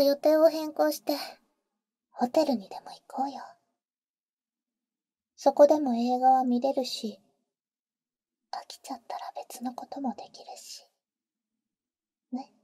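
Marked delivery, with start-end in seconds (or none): 2.1-12.5 teasing whisper. Acted. Older sister teasing the boy in his ear, whisper.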